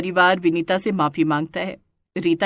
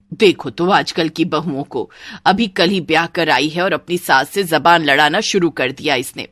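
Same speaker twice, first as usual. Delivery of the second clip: complaining mother-in-law; 4 semitones lower than usual. Acted. Mother-in-law faulting the new bride's backtalk, complaining.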